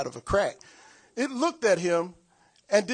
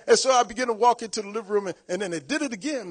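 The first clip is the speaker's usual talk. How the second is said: normal talking voice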